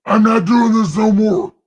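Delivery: God throwing up his hands; high, loud mock God; fed-up God. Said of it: "God's like".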